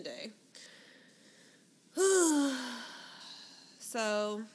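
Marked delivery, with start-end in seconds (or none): 1.9-3.4 long, heavy exhale; weary sigh. Real, not acted. Co-host finishing the recap of a couple's fight, weary sigh. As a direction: let out a big drawn-out sigh.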